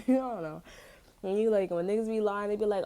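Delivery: usual speaking voice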